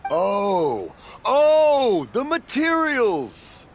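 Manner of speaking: sudden realization. Acted. Deaf father finally understands.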